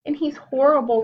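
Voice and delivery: high, loud cub's outcry; crying out. Acted. Younger brother crying out to mother, upset and loud. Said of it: "cried Ronnie".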